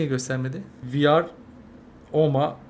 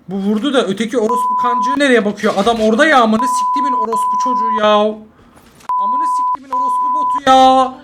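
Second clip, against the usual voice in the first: louder, higher furious cursing; furious rant; 7 semitones higher, 4 dB louder than usual. Real, not acted. Gamer cursing a teammate who looks the wrong way, furious shout. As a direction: blow up at the bot teammate.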